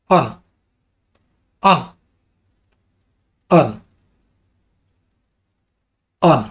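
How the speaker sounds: same clipped tune on every command word; one-word command to the voice module; steady voice commands. Shown as a demonstration. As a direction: say each command with the exact same intonation. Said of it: "intonasi harus benar-benar sama juga ya".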